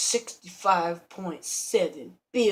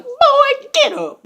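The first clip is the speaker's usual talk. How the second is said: high, loud comic shout; mock yelled order; 13 dB louder, much slower than usual. Burglar ordering a girl out of bed, mock yell.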